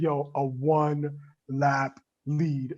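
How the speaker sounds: "one lap lead" drawn out very slowly, word by word; slow repeat. Retold or imitated. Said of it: "I need you to say that one more time".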